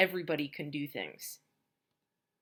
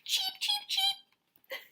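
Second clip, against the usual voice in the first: put-on baby chicks; much slower than usual. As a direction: squeak like newborn chicks.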